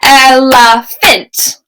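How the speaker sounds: "Elephant" drawn out slowly in three clapped beats, EL, E, PHANT; slow clapped syllables. Shown as a demonstration. Teacher slowly claps out "Elephant" for the class, syllable by syllable. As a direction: clap "Elephant" slowly, one beat per syllable. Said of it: "Let's try this one together".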